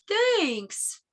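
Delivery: a touch of a bear saying thanks; lightly voiced bear, falling. Teacher voicing Bear thanking Hare.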